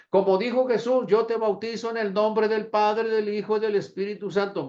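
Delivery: high, loud formula recited by rote; mock reciting. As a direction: parody someone solemnly parroting a baptism formula. Mimics a baptizer parroting the formula.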